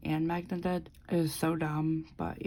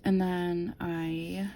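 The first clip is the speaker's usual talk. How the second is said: ordinary talk, nothing marked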